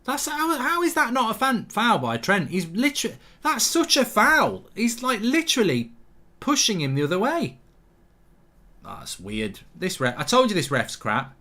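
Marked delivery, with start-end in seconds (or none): 0.0-7.6 high-pitched outraged protest; indignant disbelief. Real, not acted. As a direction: argue with the ref, voice shooting up. Disputes referee not giving a foul.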